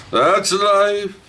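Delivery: high, drawn-out, held note; high singsong brush-off; singsong shrug. Acted. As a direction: sing it out high like a mock consolation. Mock-consoling after a disappointment.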